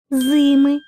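зими with the first И stressed; зими, clear strong И up front; stress on first syllable. Shown as a demonstration. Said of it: "Самі слова змінились".

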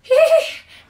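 very high loud giggle; high squealing laugh. Real, not acted. Reader laughs at tickling.

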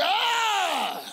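high, exasperated "Ah!"; exasperated cry. Retold or imitated. Man told the pastor's date moved again, high exasperated cry. Retold exasperation at another delay.

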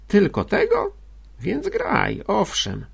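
very high, airy surprise; surprised relief. A narrator's reading. Sister learns the sacrifice is small, surprised. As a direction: leap high on the question, then come down.

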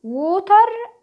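WATER as 'woo-tar', heavy final r; wrong pronunciation. Shown as a demonstration. Teacher says it exists nowhere, WATER as 'woo-tar'. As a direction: say water with an oo-like vowel and 'tar'. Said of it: "مش موجودة خالص في اي".

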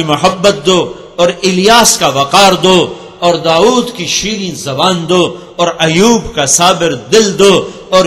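loud, slow, ringing proclamation; preaching at full force. Acted. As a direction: call out each gift to the congregation. Loud listing of prophets' gifts. (پوری قوت سے بلند آواز میں خطابت)

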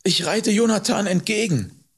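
high-pitched anxious reply; frightened, pitiful. A narrator's reading. Fleeing boy answers angry man, fearful and miserable. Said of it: "wie verängstigt und jämmerlich es klang".

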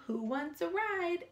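plain reading voice

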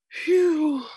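high, loud sigh of relief; sighed phew. A narrator's reading. Woman after a near miss at the radar, high relieved sigh. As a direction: let out a big high-pitched phew. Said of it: "Hazel sighed".

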